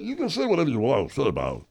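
usual speaking voice

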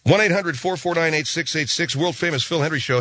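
upbeat announcer read of the number; radio show plug. Acted.

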